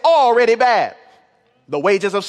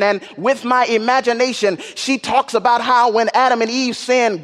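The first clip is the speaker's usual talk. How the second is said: ordinary talk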